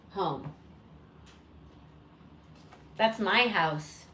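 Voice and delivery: ordinary talk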